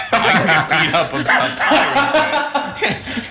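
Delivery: high, drawn-out words squeezed through laughter; cracking up at your own punchline; helpless laughing talk. Real, not acted.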